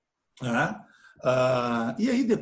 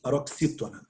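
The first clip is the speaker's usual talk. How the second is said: usual speaking voice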